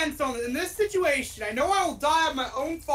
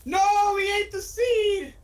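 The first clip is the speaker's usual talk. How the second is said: high, loud, drawn-out cry; dismayed shout; 10 semitones higher, 5 dB louder, slower than usual. Real, not acted. Player realizes they ate the seed, dismayed shout. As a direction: wail it out in dismay.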